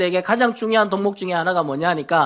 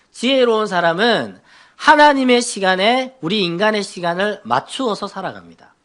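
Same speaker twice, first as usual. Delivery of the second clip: ordinary talk, nothing marked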